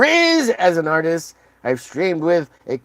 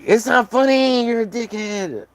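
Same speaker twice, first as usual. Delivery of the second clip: mock offended fan; 9 semitones higher than usual. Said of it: "they're like".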